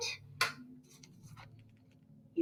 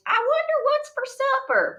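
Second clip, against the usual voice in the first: dreamy distracted mind.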